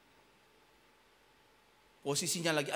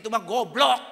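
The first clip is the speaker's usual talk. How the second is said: mocking scorn; 6 semitones higher, 13 dB louder than usual. Mocking Christians call him stupid.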